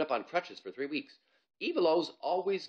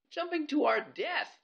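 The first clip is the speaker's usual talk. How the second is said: much higher, slow, louder; dramatic scared boy; 5 dB louder than usual. Acted. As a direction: wail it with doom.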